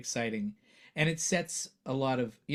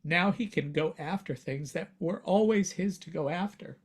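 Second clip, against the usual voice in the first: higher, moved and emotional; 6 semitones higher, 4 dB louder than usual. Real, not acted. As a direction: speak through rising emotion. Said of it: "I'm getting emotional thinking about it".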